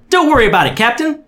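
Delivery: high, bright, bubbly call; bubbly shout. Acted. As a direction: shout it out cheerfully. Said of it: "a bubbly voice shouted".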